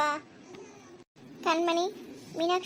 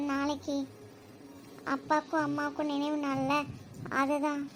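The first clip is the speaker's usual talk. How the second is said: softer, halting, with heavy pauses; subdued sorrow; 6 dB quieter than usual. Acted. Grandmother telling a child of the memorial day, subdued sorrow. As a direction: break it gently, voice dropped. Grandmother breaks sad news gently.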